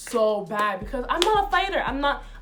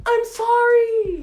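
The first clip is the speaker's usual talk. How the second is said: slow, loud, much higher call; calling out sorry; 11 semitones higher, 8 dB louder, much slower than usual. Retold or imitated. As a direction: shout an apology after her. Chasing her down the hallway.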